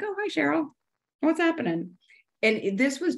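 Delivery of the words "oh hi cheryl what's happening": high, sing-song mock greeting; sweet greeting to a tree. Checked-out woman greeting her doodled tree in a high chirp. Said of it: "and I'd be like".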